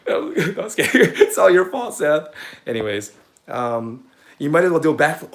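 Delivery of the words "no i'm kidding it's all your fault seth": loud, higher and laughing. Real, not acted. Joking that Seth Godin is to blame, laughing. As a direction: giggle out the joke blame.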